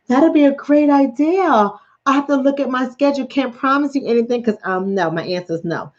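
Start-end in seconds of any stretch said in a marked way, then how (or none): 0.0-4.6 high, laughing social voice; fake-polite excuse. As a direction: pretend to love the idea while dodging it.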